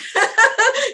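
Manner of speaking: bursts of high laughter. Real, not acted. Laughing while telling the host about lost keys, high laugh. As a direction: break into laughter.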